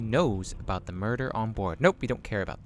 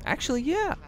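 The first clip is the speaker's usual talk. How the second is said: much higher surprised agreement; agreeing in character; 3 dB louder than usual. Acted. Hero admitting the dwarf has a point, bright. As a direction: concede brightly in the hero's voice.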